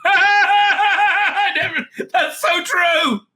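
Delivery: high, loud, slow burst of laughter through the words; real laughter breaking into the line; laughing shout. Real, not acted. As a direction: crack up and shout it out. Reacting with laughter to a joke.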